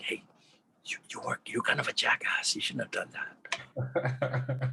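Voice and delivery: gentle, slow whispered reproach; hushed, unhurried whisper; nice soft whisper. Shown as a demonstration. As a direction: whisper it very softly and kindly. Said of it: "say it in a nice, soft whisper".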